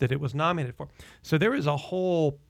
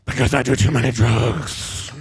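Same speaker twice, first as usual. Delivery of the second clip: louder, flat punchline in a star's voice; Jack Nicholson deadpan; 4 semitones lower, 3 dB louder than usual. Retold or imitated. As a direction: drop it like a movie-star confession. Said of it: "And he goes".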